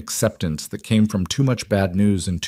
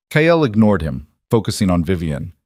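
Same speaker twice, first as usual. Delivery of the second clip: usual speaking voice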